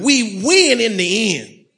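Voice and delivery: high, loud rallying call; rally the crowd with "We win"; stronger declaration. Shown as a demonstration. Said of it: "That was weak. We gotta, one more time".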